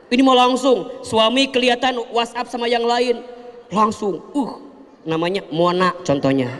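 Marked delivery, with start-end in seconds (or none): none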